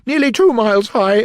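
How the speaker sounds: high, loud interjection; haughty Queen. A narrator's reading. Queen backing the King against Alice, high and loud. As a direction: chime in loudly at a high pitch.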